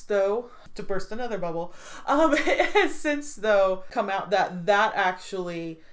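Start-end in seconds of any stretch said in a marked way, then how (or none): 2.1-3.3 laughing. Real, not acted. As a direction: restart with a laugh, slower and higher.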